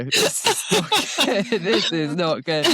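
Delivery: mock dread broken up by laughter; laughing dismay. Rider recalls getting only water at the feed zone, laughing dismay. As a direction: laugh through the retold panic. Laughingly retelling a mid-race disaster.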